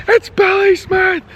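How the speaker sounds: high-pitched mock shout of a fan; very high imitated yell; imitated excited shout. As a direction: act out a fan calling out a name. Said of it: "he's shouting my name".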